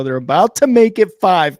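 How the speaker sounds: loud excited call-out. Real, not acted. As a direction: pump up the streak news to Kyle. Excited about winning streak.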